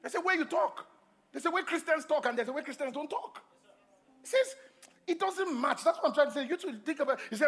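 high-pitched, insistent sermon delivery; emphatic preaching. Real, not acted. Preacher challenging how Christians talk, emphatic preaching.

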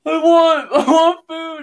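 put-on high, whiny little kid begging; a pouty kid demanding food; whiny toddler. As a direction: mimic a toddler wanting food.

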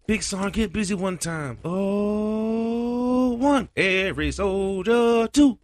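high, loud chanted ad lib shout. Retold or imitated. Platoon hyping up the sergeant with a loud chanted ad lib. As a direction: yell it out hyped. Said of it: "they be like".

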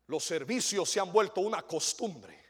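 ordinary talk

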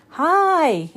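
high, loud friendly shout. A narrator's reading. Girl greeting the creature below, loud shout. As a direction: call out cheerfully. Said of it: "Annie shouted".

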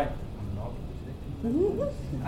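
low, soft start with no projection; not projecting. Shown as a demonstration. Teacher contrasting for pairs to practise, unprojected voice. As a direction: keep "I'm not" small and low. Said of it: "And I am projecting my voice".

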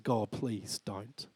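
hushed, slow begging; low, subdued appeal to God; retold quiet plea. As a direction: plead softly and low, drawing it out.

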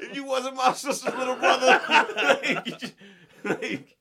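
loud, high, laughing through it; words shaken by laughter; laughing mock threat to the worker. Real, not acted.

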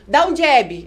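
high, loud command; demonstrated corner call. Shown as a demonstration. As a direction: call out a coded order to a fighter. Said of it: "se eu falar assim".

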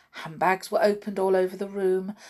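ordinary delivery, nothing marked

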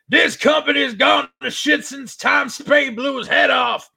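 mock promo shout; hammed-up wrestling promo. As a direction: ham it up like a washed-up wrestling manager. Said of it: "And he's all like".

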